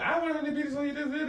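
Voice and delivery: high put-on parent voice, half joking; mock parent. Friend mimicking a parent who decides for his son, high mock voice. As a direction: play the bossy parent in a squeaky mocking voice.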